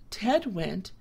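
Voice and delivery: ordinary delivery, nothing marked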